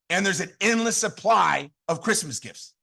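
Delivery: ordinary delivery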